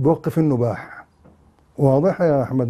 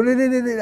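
high mocking trill of joy; mock ululation; 8 semitones higher than usual. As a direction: imitate women ululating, jeering. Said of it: "والعرب يزغردوا".

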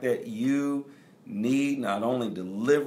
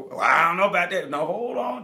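mock reluctant spouse; 8 semitones higher, 6 dB louder than usual. Mock spouse objecting to the vow.

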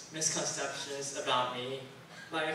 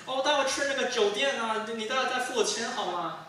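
much higher, louder mainland Mandarin voice; mom's Chinese accent; 7 semitones higher, 5 dB louder than usual. Retold or imitated. Mom telling the cab driver where to go, loud mainland Mandarin. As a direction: mom suddenly speaking like a mainlander. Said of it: "There's an accent".

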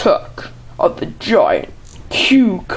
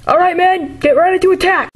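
high yelled battle orders; frantic battle shouting; 10 semitones higher than usual. Pretend. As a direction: bark orders under fire. Battle with the cucumber.

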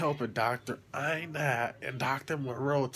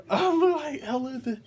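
laughing; 11 semitones higher, 5 dB louder than usual. Real, not acted. Laughing at his own look.

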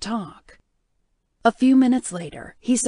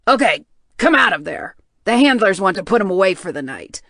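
loud; 8 dB louder than usual. Acted. Handler orders her out of cage.

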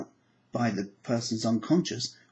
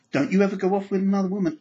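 mock jealous warning; 6 semitones higher, 4 dB louder, faster than usual.

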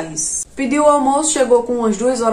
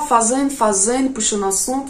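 usual speaking voice

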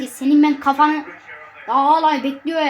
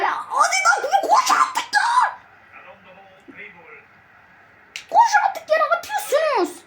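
much higher frantic scream; slower than usual. Real, not acted. Gamer screaming at his players to run, frantic scream.

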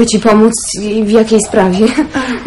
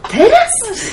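high laughing disbelief; a giggling really, now; delighted astonishment at the news; amazed laugh; 11 semitones higher than usual. Acted. Girl hears of engagement.

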